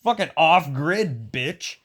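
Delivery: high, loud mock brag; mock shout at neighbor. Bragging to a jealous neighbor about the slow cooker, mock shout. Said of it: "And be like".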